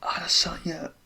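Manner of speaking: exasperated whisper of the name. Real, not acted. Streamer despairing over Adesanya, whispered.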